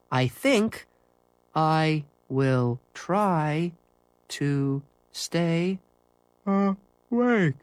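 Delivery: very slow, broken up by long pauses; drifting off to sleep. Acted. Timmy fighting sleep on Christmas Eve, slow and drowsy. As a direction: drag each word as sleep takes over. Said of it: "Falls asleep".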